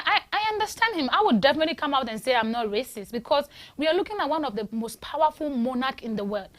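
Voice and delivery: usual speaking voice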